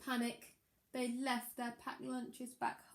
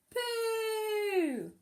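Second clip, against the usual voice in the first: high, loud, drawn-out cry of poo; shouted punchline; 12 semitones higher, 6 dB louder than usual. Acted. Reader shouting the silly punchline to the children. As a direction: belt it out for the giggles.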